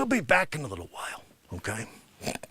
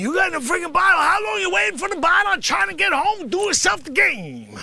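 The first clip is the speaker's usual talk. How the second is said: high, loud comic guy voice; comic character bit; 11 semitones higher, 6 dB louder than usual. Acted. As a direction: play a loud wisecracking character. Comic character bit at vending machine.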